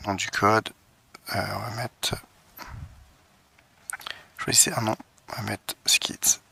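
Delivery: slow unvoiced whisper. Real, not acted. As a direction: whisper it while typing. Murmuring while typing a name.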